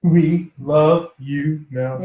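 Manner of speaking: slow, low automated robot voice. Retold or imitated. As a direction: sound like a machine reading. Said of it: "It really does sound like a automated voice".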